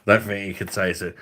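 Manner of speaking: ordinary delivery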